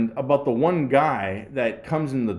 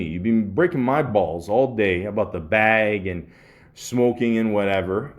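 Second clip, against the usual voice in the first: ordinary talk, nothing marked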